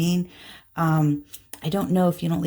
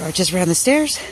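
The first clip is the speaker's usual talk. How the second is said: ordinary delivery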